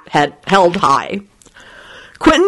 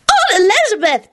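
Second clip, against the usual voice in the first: put-on very high, delighted little boy; happy yell. A narrator's reading. Teddy greeting Elizabeth, yelling happily. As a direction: call out happily, pitched way up. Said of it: "Teddy yelled happily".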